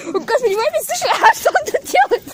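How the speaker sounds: high, fast panicked cry. Real, not acted. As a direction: blurt it out in alarm. Hears whistle again, panics.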